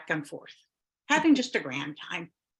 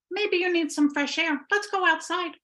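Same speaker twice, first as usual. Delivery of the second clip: high witch voice; 9 semitones higher than usual. Acted. Witch suggests going out.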